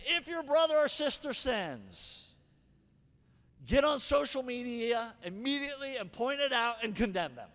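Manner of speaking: a hint of a preacher parodying; mock pronouncement. Shown as a demonstration. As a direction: ham up the wrong reading. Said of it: "I'm just joking again".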